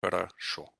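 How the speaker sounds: "хорошо" said huh-rah-SHO; only the final O full in "хорошо"; reduced vowels. Shown as a demonstration. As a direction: say "хорошо" with the first two O's reduced to uh and ah. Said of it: "is pronounced".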